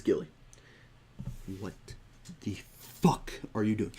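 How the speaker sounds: halting, deliberate mock reprimand; dead serious. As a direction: leave heavy pauses, imitating someone truly serious. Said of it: "he's not saying this in a joking manner".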